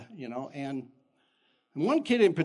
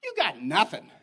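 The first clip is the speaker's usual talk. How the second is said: high, loud scoffing reply; defiant retort; 4 dB louder than usual. Retold or imitated. [name] answering the bully, scoffing. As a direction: brush the bully off, rising to loud. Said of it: "I go".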